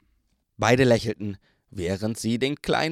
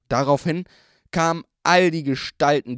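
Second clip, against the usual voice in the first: ordinary delivery, nothing marked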